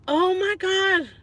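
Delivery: ordinary delivery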